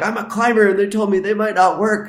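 high, loud, anxious worry; worried self. Retold or imitated. Climber worrying to police about fingerprints, high anxious whine. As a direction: fret like it could ruin everything. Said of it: "I'm like".

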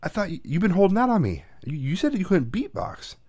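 high, playful accusation; mock accusing. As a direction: tease him for holding out. Teasing son, mock accusation.

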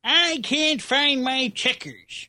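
put-on slow, high, confused old caller; old man voice. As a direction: whine like a lost old man. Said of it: "the old man who calls in".

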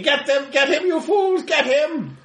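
high-pitched mock scream of command; screaming villain. Industrialist orders his sludgies to attack, high screamed command. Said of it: "The industrialist is screaming".

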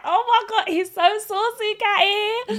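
high, giddy gushing over a crush; gushing girl. Retold or imitated. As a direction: squeal it like a smitten schoolgirl. Girl gushing over new boy.

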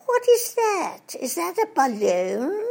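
silly upper-class English twit, high and loud; Mister Twit. Retold or imitated. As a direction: squeal it out like a dithering toff. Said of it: "Mister Twit looks up in the sky and says".

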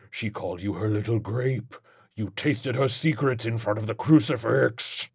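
taunting demon, held back from shouting; sly demonic accusation; insinuating demon Legion. Acted. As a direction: needle the priest with his sins.

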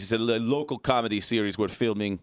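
put-on light comic Russian accent, pitching a show; mock Russian accent. Host pitching his comedy series in mock Russian accent. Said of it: "the series I wrote in Russian accent".

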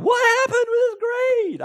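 put-on high furious yell at a kid; angry shout. Parent yelling at a kid over grades, angry shout. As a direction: explode at the bad grade. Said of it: "I just lose it".

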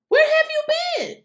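high, loud mock outrage at a clueless lady; exasperated shout. Vlogger scolding a tweeter who just caught on, exasperated shout. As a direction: yell it like she slept through everything.

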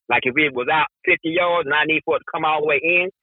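ordinary talk, nothing marked

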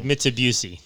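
MITSUBISHI as "Mitsa-BYOO-see"; mispronounced Mitsubishi. Retold or imitated. Host quoting a former coworker, Mitsubishi as "Mitsa-BYOO-see". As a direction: end Mitsubishi like the name Busey. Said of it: "He always said".

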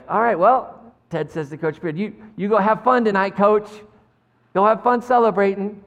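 bright, loud, cheerful send-off; cheery coach voice. Retold or imitated. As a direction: play an upbeat coach. Ted voiced cheerfully to Beard.

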